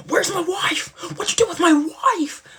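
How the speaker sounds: high, loud mock-heroic demand; Leo imitation. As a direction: holler it high, hamming up his outrage. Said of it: "when he would try to be something".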